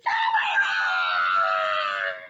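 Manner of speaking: low, slow, loud bellow; exasperated outburst. Acted. Gerald tells Piggy where the birds should go, bellowing. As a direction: Gerald blowing his top.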